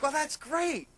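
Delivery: high, smiling, mock-pleased; smiling reply. To his sister at the slot machine, smiling mock-pleased. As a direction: grin through it. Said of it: "I'm smiling like".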